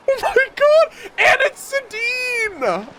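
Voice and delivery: excited shout. Real, not acted. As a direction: scream with joy at the reveal. Shouts at a character reveal.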